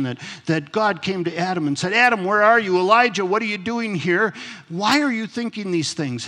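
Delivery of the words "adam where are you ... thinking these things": raised, louder voice, pitched high; calling out. Acted. God questioning Adam and Elijah, calling out. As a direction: call out each question insistently from on high.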